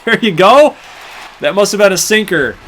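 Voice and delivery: loud and high, still buzzing from the homer; hyped gamer celebrating a solo shot; excited celebration. Real, not acted. Celebrating the home run.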